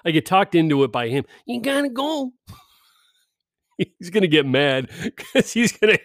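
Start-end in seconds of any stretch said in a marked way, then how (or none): 1.4-2.3 high put-on voice of a pushy friend; mock the friend's nagging, higher; imitating Elliot. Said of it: "I don't sound like that dude".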